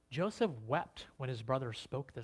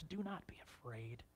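hushed, breathy whisper of comfort; 15 dB quieter than usual. Acted. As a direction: whisper it gently to calm them. Joseph comforts his frightened brothers.

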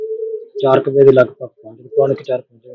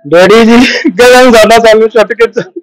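higher, louder, urgent retelling of the news; retold distressed cry; 7 semitones higher, 5 dB louder, slower than usual. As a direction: voice the daughter's panicked call, pushed up high. Daughter's death call retold. (ਉੱਚੀ ਤੇ ਘਬਰਾਈ ਹੋਈ ਆਵਾਜ਼)

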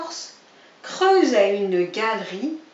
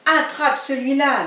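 louder jab; fighting shout; 4 semitones higher, 7 dB louder than usual. Acted. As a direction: throw a punch with the words. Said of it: "disait l'autre".

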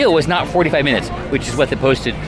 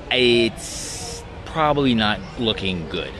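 gloomy; 3 semitones lower, 6 dB quieter, slower than usual. Shown as a demonstration. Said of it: "Wait, it's probably not looking good".

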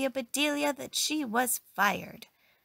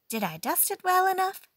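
eager Amelia voice; 11 semitones higher, 5 dB louder than usual. Acted. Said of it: "asked Amelia Bedelia".

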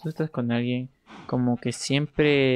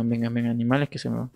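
usual speaking voice

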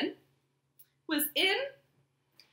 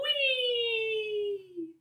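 swooping high whee; high squeal; 14 semitones higher than usual. Pretend. Teacher squeals as frog jumps into pool.

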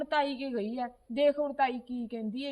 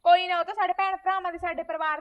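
loud retort; 4 semitones higher, 7 dB louder than usual. Acted. Niece argues back loudly.

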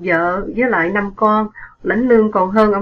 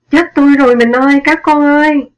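sudden loud, high, fast shriek; screaming in pain; 6 semitones higher, 7 dB louder than usual. A narrator's reading. Wife shot at the dinner tray, screaming loud. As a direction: cry out as the bullet hits. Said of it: "thét lên".

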